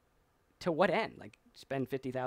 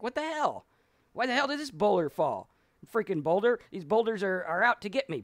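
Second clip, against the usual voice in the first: high, loud exasperated yelling at the sky; hammed-up fury at falling boulders; a man cursing the boulders that keep falling; mock outrage; 7 semitones higher, 6 dB louder than usual.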